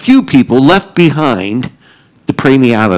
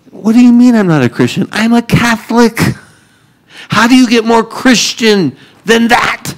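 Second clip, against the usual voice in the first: higher, fast, offended protest; indignant disbelief; 8 semitones higher than usual. Retold or imitated. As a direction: act baffled and hurt by the accusation. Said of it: "I'm like".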